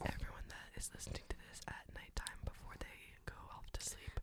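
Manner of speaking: soft bedtime ASMR whisper. Real, not acted. Whispering to nighttime listeners before they sleep. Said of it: "hear my beautiful voice".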